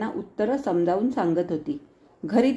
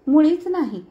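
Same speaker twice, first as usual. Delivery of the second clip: put-on high, louder child's denial; little girl; 7 semitones higher, 5 dB louder than usual. A narrator's reading. Small girl answers Nana's teasing question, high child voice.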